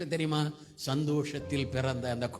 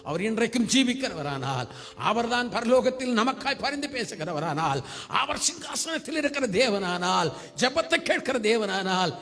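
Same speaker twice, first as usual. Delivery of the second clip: high, rising chain of emphatic clauses; full-force preaching; 6 semitones higher, 5 dB louder than usual. Real, not acted. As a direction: proclaim each truth with mounting fervour. (முழு வேகத்தில் உரத்த பிரசங்கம்)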